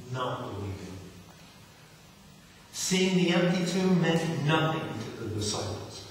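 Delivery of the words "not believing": softer and lower, pressing the word "Not"; quiet emphatic repeat. Shown as a demonstration. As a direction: drop to a low, hushed repeat.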